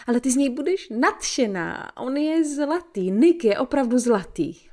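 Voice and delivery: lightly voiced mother, smiling and persuading; a hint of a mother selling it cheerfully; with a smile. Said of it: "s úsměvem na tváři".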